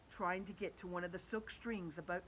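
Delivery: ordinary delivery, nothing marked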